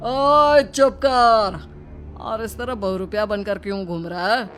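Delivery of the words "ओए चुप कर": loud, high, drawn-out order to shut up; angry silencing. Acted. Robber silencing his victim, angry and loud. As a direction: cut them off with a long, loud call.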